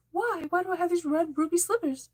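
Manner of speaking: high, wide-eyed surprised Dorothy voice; Dorothy impression. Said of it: "Didn't she always sound surprised?".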